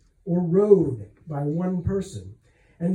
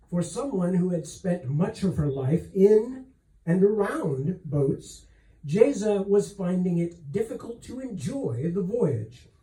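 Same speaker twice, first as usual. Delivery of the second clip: ordinary delivery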